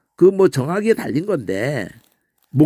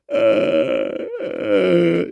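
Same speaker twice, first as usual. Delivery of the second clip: pained groan demo; much slower than usual. Shown as a demonstration. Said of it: "아플 때".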